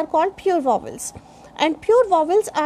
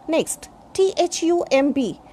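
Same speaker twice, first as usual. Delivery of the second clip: ordinary talk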